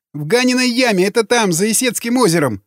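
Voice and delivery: high, whining prisoner voice; frightened confession. A narrator's reading. Prisoner at knifepoint explains, frightened. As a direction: plead and inform in a high, scared pitch.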